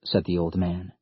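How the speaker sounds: ordinary delivery, nothing marked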